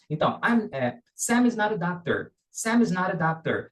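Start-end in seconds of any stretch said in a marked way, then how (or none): 1.1-2.3 "Sam is not a doctor" run together as one word; connected speech. Shown as a demonstration. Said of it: "como se fosse uma coisa só". 2.5-3.7 "Sam is not a doctor" as one unbroken word; connected speech. Shown as a demonstration. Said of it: "como se fosse uma coisa só".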